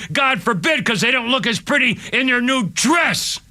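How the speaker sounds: high, emphatic, indignant tirade; impassioned anger. Real, not acted. Ex-Marine contrasting his dead platoon mate with new recruits, angry. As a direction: hammer out each phrase high with real outrage.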